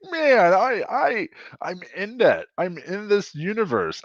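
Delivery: high starstruck wonder; awed excitement. Retold or imitated. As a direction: marvel at being part of it. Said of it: "And I was like".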